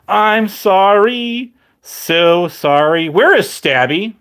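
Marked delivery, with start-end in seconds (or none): 0.1-3.1 drawn-out, slow fake sorry; mock apology. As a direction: stretch the apology out slowly. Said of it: "You need to make a video of the apology".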